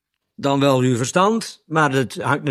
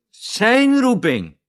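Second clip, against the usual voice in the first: "zijn" drawn out slow and pitched high; stress on ZIJN; 6 semitones higher, much slower than usual. Shown as a demonstration. Preacher reading the verse to his listeners, stress on ZIJN. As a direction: lean hard and long on the word "zijn". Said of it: "er staat met nadruk op zijn".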